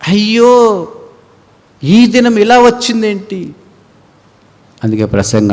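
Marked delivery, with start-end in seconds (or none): 0.1-3.5 higher, louder mock cry; acted wail. Left-behind sinner crying about the day, mock wail. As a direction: lament the day.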